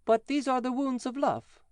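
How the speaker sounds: put-on child reassuring the angry giant. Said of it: "answered the child".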